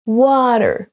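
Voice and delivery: "Water" as WA-ter, first syllable long and strong; first-syllable stress. Shown as a demonstration. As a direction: hit the WA in "Water", let the end fall. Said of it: "On va accentuer sur la première".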